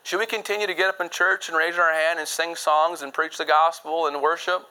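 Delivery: usual speaking voice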